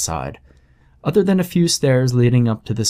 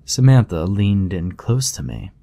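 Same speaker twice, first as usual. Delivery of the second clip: usual speaking voice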